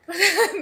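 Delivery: quick high bright laugh; a burst of laughter; short laugh. Real, not acted. Laughs after the demo.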